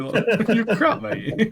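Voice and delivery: laughing mock insult. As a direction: mimic the put-down while cracking up. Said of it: "mocking the mentor's insult".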